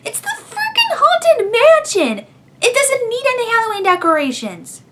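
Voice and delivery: high, incredulous outburst; exasperated. Real, not acted. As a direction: shout it in disbelief. Exasperated rant at the video.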